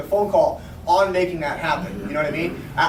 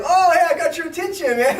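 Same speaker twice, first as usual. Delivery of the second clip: pitched-up teasing hello, man; gleeful mock greeting; 9 semitones higher than usual. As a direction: crow that you finally got him. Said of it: "I was like".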